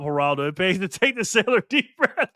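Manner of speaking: ordinary talk, nothing marked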